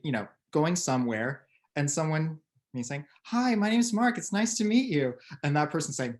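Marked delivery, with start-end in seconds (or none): 3.2-5.1 higher-pitched mock-polite greeting; friendly introduction. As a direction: play an eager newcomer saying hello. Acts friendly newcomer introducing himself.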